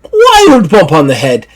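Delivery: high, breezy Scottish voice; high Scottish woman laughing it off; Scottish lass. Acted. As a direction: brush it off with a cheery Scottish lilt. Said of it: "Deirdre's like".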